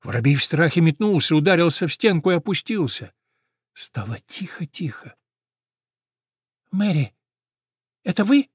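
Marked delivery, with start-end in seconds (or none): 3.7-5.2 soft breathy whisper. A narrator's reading. Hush after the noise. 6.7-8.5 high, anxious little calls; frightened sparrow. Acted.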